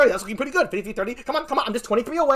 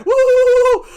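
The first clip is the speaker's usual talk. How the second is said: giddy high loud whoop; loud high woo, catching up in the vote; 13 semitones higher, 8 dB louder than usual. Real, not acted. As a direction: let out a celebratory high woo. Gets giddy as his side catches up.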